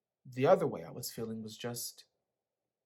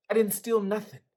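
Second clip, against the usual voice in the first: protesting; 8 semitones higher, 8 dB louder than usual. A narrator's reading. Son protests to parents.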